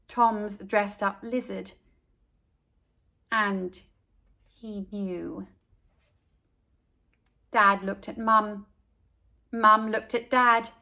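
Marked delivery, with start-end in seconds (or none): none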